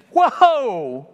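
shocked whoa. Retold or imitated. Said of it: "it's just this".

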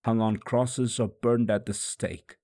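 plain reading voice

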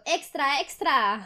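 mild newsboy call; newsboy shout. Pretend. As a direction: call out the news lightly. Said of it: "la Mini Mari gritando".

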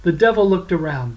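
ordinary delivery

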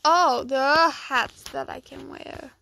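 normal talking voice

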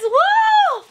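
very high-pitched "woo"; high celebratory woo; excited whoop. Real, not acted. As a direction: let out a real squealed whoop. Cheering coupons found in mail.

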